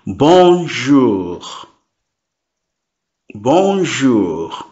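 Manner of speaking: bonjour drawn out slowly; slow repeat. Shown as a demonstration. Teacher repeats bonjour slowly for beginners. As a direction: say bonjour slowly for students to copy. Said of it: "I will repeat slowly".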